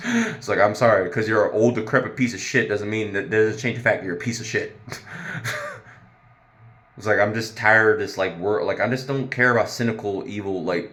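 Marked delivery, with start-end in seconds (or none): 4.8-5.8 a shrill squeal of a laugh; squeaky high laugh. Real, not acted. Laughing at her own rant, squeaky and high. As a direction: burst into a tiny squeaking laugh.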